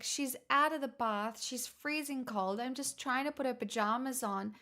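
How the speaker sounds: usual speaking voice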